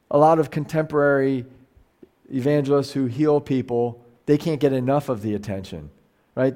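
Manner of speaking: usual speaking voice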